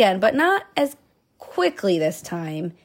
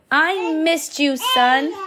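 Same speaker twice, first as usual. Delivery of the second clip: tender greeting; 7 semitones higher, 4 dB louder, slower than usual. Acted. Captain greets her son.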